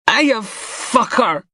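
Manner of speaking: high, slow strangled cry; broken, high cry after choking; pained cry. Acted. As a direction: gasp it out in pain, way up high. Gasping after being choked.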